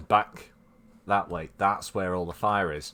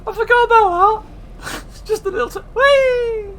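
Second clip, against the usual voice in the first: much higher and louder, squealing with glee; excited squeal; 8 dB louder than usual. Real, not acted. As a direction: whoop like on a slide. Streamer sliding down, delighted.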